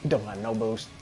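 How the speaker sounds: ordinary talk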